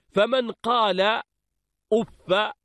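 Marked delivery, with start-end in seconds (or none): 1.9-2.5 أف ending in short "a", "uffa"; fatha reading. Shown as a demonstration. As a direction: say أف carefully as "uf-fa". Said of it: "جعله بمنزلة مد".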